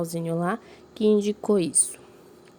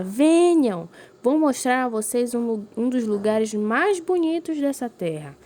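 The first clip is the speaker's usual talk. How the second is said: enthusiastic invitation; 4 semitones higher than usual. Shown as a demonstration. Teacher demonstrating the character's enthusiasm to pupils. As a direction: call them over, thrilled to show the place. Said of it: "Percebam que ela fala".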